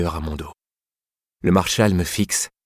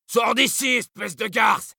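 much higher, angry bawl; snarling in pain; slower than usual. Acted. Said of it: "Il braille, rendu hargneux par la douleur".

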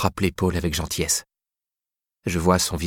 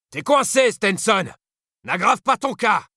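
much higher, commanding lawman call; calm and brutal; slower than usual. Acted. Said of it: "calme et brutale".

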